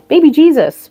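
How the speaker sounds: high cooing doll voice. Real, not acted. Showing the doll to viewers, high cooing baby talk.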